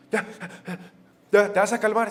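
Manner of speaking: loud, high, exasperated dad; retold scolding. Father to soaked son under the shower, loud stern demand. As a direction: demand it like a fed-up parent.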